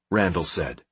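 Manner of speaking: ordinary delivery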